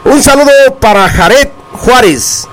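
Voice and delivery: hyped radio announcer greeting; announcer shout-out. Acted. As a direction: call the greeting out like a DJ.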